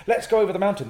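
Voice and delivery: loud, high call to the group; acted offer. Shown as a demonstration. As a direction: call it out eagerly.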